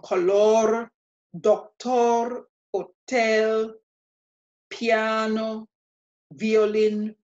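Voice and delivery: "color, doctor, hotel, piano, violin" in Spanish, spaced out; slow, separated Spanish words: "color, doctor, hotel, piano, violin"; Spanish pronunciation. Shown as a demonstration. Teacher lists cognates for learners, each in Spanish pronunciation. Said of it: "many other exact cognates out there".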